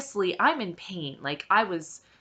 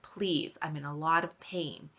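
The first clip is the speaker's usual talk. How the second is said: soft, pleading, a little quick; quietly begging the staff for relief; quiet retold plea; 3 semitones lower, 9 dB quieter than usual. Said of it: "I said".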